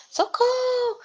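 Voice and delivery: high, loud yell of a terrified priest; panicked scream for help. Acted. Priest screaming for help, bitten by the tortoise. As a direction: scream for help as pain hits. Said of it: "uma gritaria".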